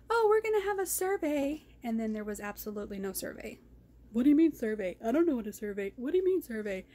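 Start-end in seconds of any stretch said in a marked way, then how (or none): 0.1-1.6 high mocking voice of the meeting host; mocking imitation. Vlogger mocks the meeting host's empty survey promise, high and mocking. 4.1-6.9 mock confusion. Mocking hosts joking about survey.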